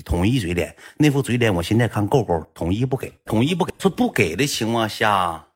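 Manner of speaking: ordinary talk, nothing marked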